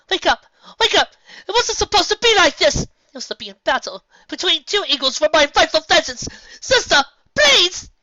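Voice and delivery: very high, very loud frantic shouting; screaming at her sister to wake; desperate wailing plea. A narrator's reading. Nightmare Moon begs dying Celestia, frantic high shouting.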